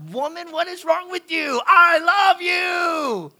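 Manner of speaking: high, loud, slow "I love you" wail; hammed-up frustrated husband; husband yelling at wife who won't say it back; exasperated mock shout. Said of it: "I'm like".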